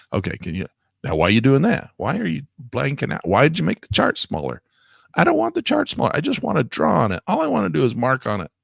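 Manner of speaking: real annoyance at the software; frustrated rant. Real, not acted. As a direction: scold the program that shrank the chart. Ranting at the chart software.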